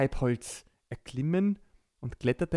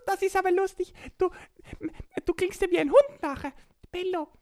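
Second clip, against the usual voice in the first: giggling; 4 dB louder, faster than usual. Acted. Borki laughs at the name.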